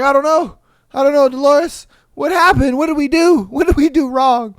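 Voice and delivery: hammed-up despairing father; mock distraught dad. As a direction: wail at what went wrong.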